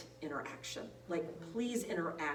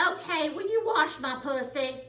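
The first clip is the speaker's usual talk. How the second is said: high, loud character voice; imitated loud reply; 7 semitones higher, 8 dB louder than usual. Imogene answering about her shower, imitated loud high reply. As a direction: call it back loudly, imitating the resident. Hard-of-hearing resident replies loudly.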